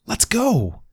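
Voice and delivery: high bouncy call; eager to run; a lightly voiced happy dog; excited dog. Dog bounding off.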